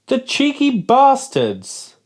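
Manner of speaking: high, slow indignant outburst; real outrage at the label; outraged. Real, not acted. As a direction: spit it out in disbelief. Outraged at sugar claim.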